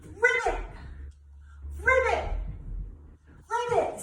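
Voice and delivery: high, loud playful "Ribbit" croak; loud frog ribbit. Shown as a demonstration. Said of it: "let's jump like a frog and go ribbit".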